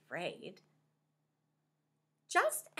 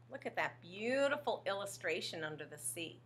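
plain reading voice

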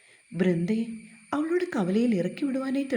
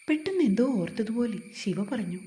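ordinary delivery

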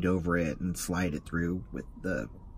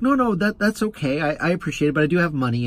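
flustered refusal; 8 semitones higher, 6 dB louder, faster than usual. Retold or imitated. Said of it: "It's, I was stunned".

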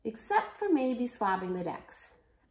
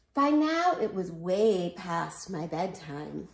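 ordinary delivery

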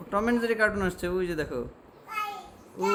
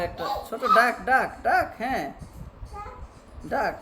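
higher and louder, eager about the duck; excited pointing; 5 semitones higher, 4 dB louder than usual. Real, not acted.

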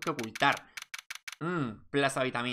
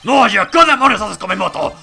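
furious shout at a snooper; gritando enfadado; 6 semitones higher, 16 dB louder than usual. Acted. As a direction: yell it, outraged and loud. Owner catches them at scooter. (grito furioso por su moto)

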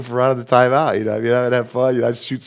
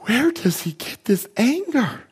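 much higher, slow, put-on old lady's voice; imitating grandma; 11 semitones higher than usual. Grandma, who has the temper herself, wondering aloud at the grandson, in a high mock voice. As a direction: ham up a scandalized grandma. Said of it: "my grandma go".

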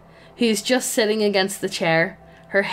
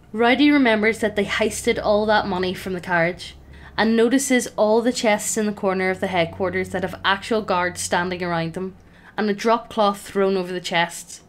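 ordinary delivery

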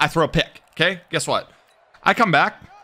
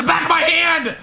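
hype shouting; 12 semitones higher, much slower than usual. Real, not acted. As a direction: celebrate the big play. Hype shouting after play.

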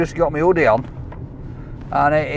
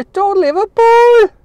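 high, loud mock Liverpool accent; attempted Liverpool accent; 8 dB louder, slower than usual. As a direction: have a bad go at a Scouse accent, pitched right up. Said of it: "I can't do accents".

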